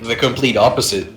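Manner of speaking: normal talking voice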